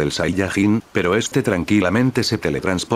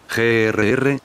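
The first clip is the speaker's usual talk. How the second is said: ordinary delivery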